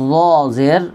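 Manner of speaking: ordinary delivery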